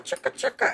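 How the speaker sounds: whispered "chicka, chicka", high and unvoiced; breathy mock wrench sound effect; chicka chicka noise. Shown as a demonstration. Host showing the wrench loosening with a whispered chicka chicka. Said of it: "you do the little chicka".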